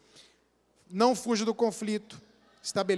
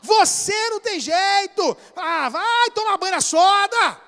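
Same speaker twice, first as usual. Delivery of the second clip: high, loud, slow exasperated scolding; hammed-up shout of a fed-up spouse; mock angry outburst; 10 semitones higher, 6 dB louder, slower than usual. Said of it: "se ela vier falar". (bronca exagerada e debochada, aos gritos)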